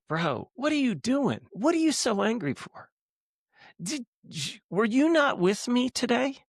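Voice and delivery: high-pitched impression of an incredulous buddy; imitated incredulity. Friend questioning his angry buddy, imitated high and incredulous.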